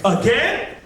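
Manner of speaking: high, squeaky mock disbelief; hammed-up dismay at a new day; dismayed waking cry. Depressed man wakes to another day, squeaking in disbelief.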